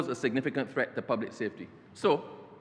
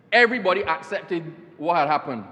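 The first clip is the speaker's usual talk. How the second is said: ordinary talk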